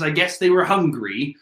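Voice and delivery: ordinary delivery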